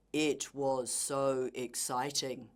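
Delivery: slow, low robot-like delivery with no excitement; robotic monotone. Shown as a demonstration. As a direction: drone it out word by word like a robot. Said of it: "Try not to sound like a robot".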